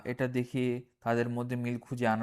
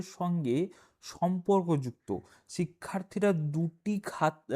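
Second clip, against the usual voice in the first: reading the textbook instructions in a slightly raised voice; reading aloud; 4 semitones higher than usual. Acted. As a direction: read the book's guidance out to the class.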